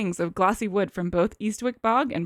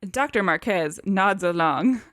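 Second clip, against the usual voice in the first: ordinary talk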